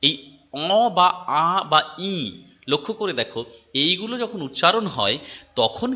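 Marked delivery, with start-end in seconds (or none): 0.5-2.4 অ, আ, ই drawn out very slowly through the nose; nasalized vowels. Shown as a demonstration. Teacher demonstrating nasal vowels to students. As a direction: let some air out the nose on অ বা আ বা ই. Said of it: "খানিকটা ধ্বনি নাকের ভিতর থেকে প্রবাহিত করো".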